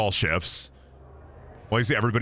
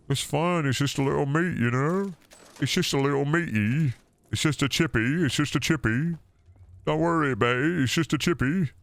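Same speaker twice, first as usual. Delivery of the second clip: higher, light British chippy voice; mock British accent; 5 semitones higher than usual. Streamer plays a Brit shrugging off horse meat, mock accent. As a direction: reassure like a cheeky Brit.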